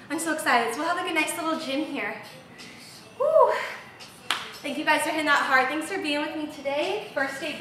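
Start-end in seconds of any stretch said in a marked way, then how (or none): 3.2-3.5 high, loud "woo"; exertion whoop. Real, not acted. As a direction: whoop it out between sets. Whooping while resting after set.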